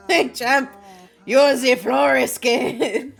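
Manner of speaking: put-on slow French-accented bartender. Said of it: "I don't know why he's fucking awfully fucking French".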